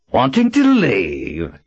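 grating villain voice. A narrator's reading. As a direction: drawl it with slow menace. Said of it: "in the grating voice".

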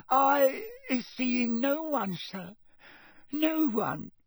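high, slow, tearful elf squeak; crying house elf. A narrator's reading. Terrified elf denies seeing anyone, high and tearful. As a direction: gulp and deny through tears.

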